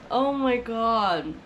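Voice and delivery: overwhelmed, drawing out the words between sobs; crying. Real, not acted. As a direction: laugh through happy tears. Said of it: "I'm crying, bro".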